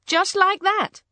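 wide-eyed, high-pitched wonder; astonished. A narrator's reading. Surprise at horse turned wife.

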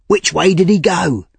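high, urgent question; urgent cry. Acted. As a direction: demand an answer fast. Giles chasing intruder, urgent.

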